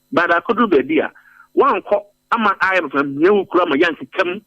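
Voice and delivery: normal talking voice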